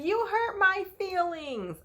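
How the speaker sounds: put-on high, slow hurt child voice; whiny little girl. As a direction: pout the line like a wounded kid. Child sulks at the nanny.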